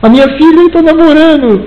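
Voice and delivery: high-pitched half-mock sob of a worried dad; lightly voiced crying father; retold tearful father. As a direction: pitch it up like a father near tears. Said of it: "se encontrou com ele chorando".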